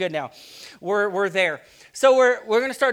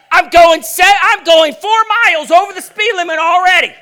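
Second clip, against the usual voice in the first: loud, high, laughing outburst at a driver; road rage; 10 semitones higher, 13 dB louder than usual. Acted. Preacher acting annoyed at a tailgater, shouting. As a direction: yell at the car behind, half laughing.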